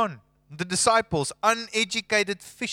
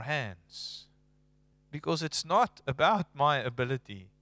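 sermon delivery; 5 semitones lower than usual. Acted. Preacher's slow build to soft close.

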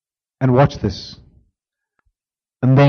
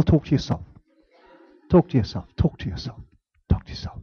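softer each time until whispered; fading whisper; 4 semitones lower, 10 dB quieter than usual. Shown as a demonstration. Preacher repeating instruction to his listeners, fading. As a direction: let it fade to a whisper. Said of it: "Softly, softly".

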